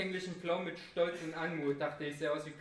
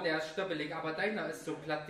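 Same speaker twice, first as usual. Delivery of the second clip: ordinary talk, nothing marked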